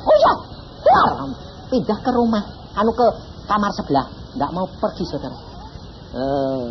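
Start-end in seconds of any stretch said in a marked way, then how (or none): 0.1-1.1 very high shrieking woman's voice; wife screaming. Retold or imitated. Wife screams the demon is clinging to her, high shriek. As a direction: shriek it, terrified, in a woman's pitch. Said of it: "Dia tahu".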